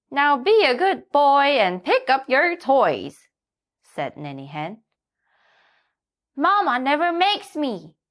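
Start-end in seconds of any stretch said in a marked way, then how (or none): 0.1-3.3 high, louder hen voice; a bright, clucking hen; Nanny Hen. A narrator's reading. Hen greets the little donkey in a high voice. 6.4-8.0 put-on child donkey wheedling; Little Donkey. A narrator's reading. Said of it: "said Little Donkey".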